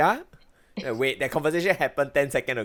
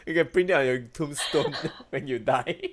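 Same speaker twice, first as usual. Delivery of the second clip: ordinary talk, nothing marked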